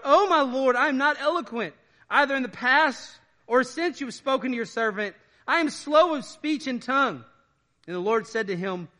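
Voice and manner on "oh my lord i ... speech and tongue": high, loud pleading voice of Moses. Acted. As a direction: plead like a reluctant prophet.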